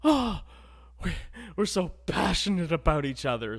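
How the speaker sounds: high, loud hammed-up gushing; mock passionate lovers. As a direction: overact the swooning romance.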